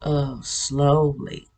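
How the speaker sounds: slow, imitating the old voice; slowly enunciated. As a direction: stretch each sound out. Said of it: "the letters and the sounds were enunciated".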